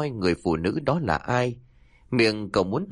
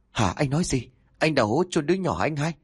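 higher, startled question; shocked question; 7 semitones higher, faster than usual. A narrator's reading. Brother confronting brother about the buried baby, startled and higher. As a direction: catch him on his slip. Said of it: "bắt đầu nghi ngờ".

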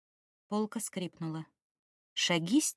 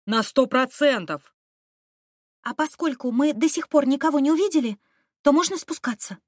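put-on confident young man; 5 semitones higher, 4 dB louder than usual. Student answers confidently.

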